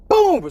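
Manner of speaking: loud high boom; loud spoken slam effect; door slam effect. Retold or imitated. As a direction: punch out the slam sound.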